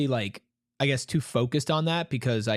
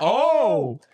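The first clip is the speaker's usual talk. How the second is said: loud, high startled Oh; surprised shout; 7 semitones higher, 7 dB louder than usual. Real, not acted. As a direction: burst out in surprise. Reacting to the touchdown.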